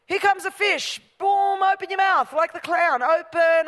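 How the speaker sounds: high, loud, slowed playground shout; playful fish-tossing shout. Pretend. Preacher pretending to toss fish, hammed-up shout. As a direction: hurl it like a fairground pitcher calling to the crowd.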